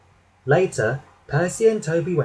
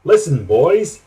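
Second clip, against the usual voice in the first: raised voice calling for attention; 6 dB louder than usual. A narrator's reading. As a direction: call the crew to listen. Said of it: "he called".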